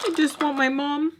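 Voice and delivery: small, high whiny voice; babyish pleading. Retold or imitated. Daughter wanting her mom to herself, babyish pleading. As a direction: plead like a little baby who misses mom.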